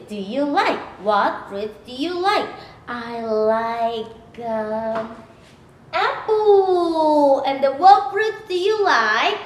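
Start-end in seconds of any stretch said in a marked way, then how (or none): none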